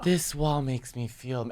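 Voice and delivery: soft, slow, dreamy mock reading; hushed, drawn-out parody of a dreamy line. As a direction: whisper it like an enchanted actress, slowly. Mocking actress's dreamy monologue.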